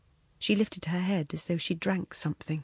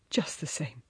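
quiet echo; 5 semitones lower, 7 dB quieter than usual. A narrator's reading. He echoes her words.

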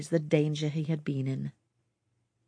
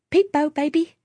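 high, bright playful peep-bo, much higher and louder; merry; 11 semitones higher, 8 dB louder than usual. A narrator's reading. Mother playing peep-bo to sob-ing baby, merry and high. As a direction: coo it merrily to cheer the crying baby. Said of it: "as merrily as she could".

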